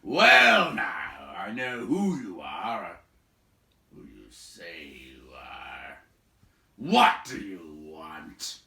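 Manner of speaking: loud, slow suspicious boom; gruff giant. A narrator's reading. Big man questioning the wizard, gruff slow boom. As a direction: boom then drop low and slow. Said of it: "the big man less gruffly".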